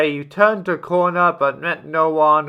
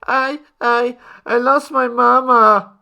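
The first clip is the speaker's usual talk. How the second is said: put-on high, stammering little-child wail; a lost toddler crying for its mother; wailing baby; 8 semitones higher, 4 dB louder than usual. A narrator's reading. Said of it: "wailed the baby".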